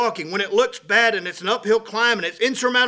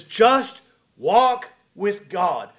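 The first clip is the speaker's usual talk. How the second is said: drawn-out, weighted words; pastor's gentle slow counsel; slow emphatic advice; 3 dB louder, much slower than usual. Retold or imitated. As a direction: lean on each word slowly.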